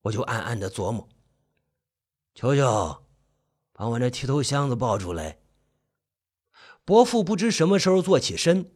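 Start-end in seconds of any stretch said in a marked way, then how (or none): 2.3-5.4 put-on low, subdued old uncle's voice; hoarse, low uncle. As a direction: call softly in a low, husky voice. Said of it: "伯父的声音沙哑的有几分低沉".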